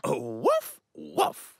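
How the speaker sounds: dog bark. Acted. Dog character barks.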